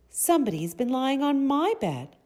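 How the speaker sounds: high, sweet mother bear voice, projected; high-pitched, loud, sweet storybook bear; sweet Mama Bear. A narrator's reading. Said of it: "in her sweet, soft voice".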